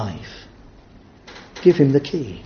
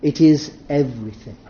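usual speaking voice

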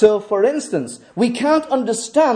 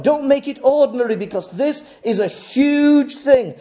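impassioned preaching; 5 semitones higher than usual. Acted. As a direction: exhort the congregation with full conviction. Impassioned exhortation.

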